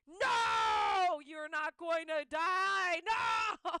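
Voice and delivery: high, loud desperate screaming of no; panicked shout. Retold or imitated. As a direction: shriek at the dog not to die. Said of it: "looking at her like".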